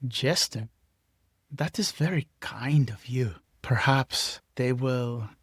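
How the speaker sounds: put-on lightly accented villainous diplomat, taking time over words; Ogre's put-on accent. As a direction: speak deliberately in an affected accent. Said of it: "this accent thing".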